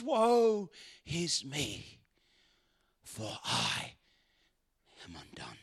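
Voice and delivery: slow, soft, fading into a whisper; broken whisper. Acted. Preacher voicing Isaiah humbled before God, whispering. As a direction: confess it under your breath, undone. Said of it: "And he says".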